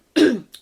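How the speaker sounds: loud throat clear. Real, not acted. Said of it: "My voice is going".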